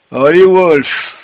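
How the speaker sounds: high, loud, drawn-out mock yawn. A narrator's reading. Rip waking up and rousing his dog, yawning. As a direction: stretch the words through a big yawn. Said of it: "dit-il en bâillant".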